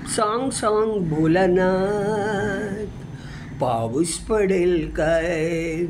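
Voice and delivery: put-on slow sing-song recital of a children's poem; poem recitation. Reciter sings a child's rain poem to Bholanath, lilting.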